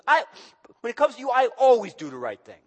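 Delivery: loud, emphatic "I always do the right thing"; mad God. Acted. God insisting to the people that he always does right, angry. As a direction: hammer home your innocence, angry. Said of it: "He's mad".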